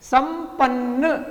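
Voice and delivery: संपन्न stretched slowly with m before p; teacher drawing out संपन्न; slow m demo. Shown as a demonstration. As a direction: draw out संपन्न carefully. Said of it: "प इस वर्ग में है".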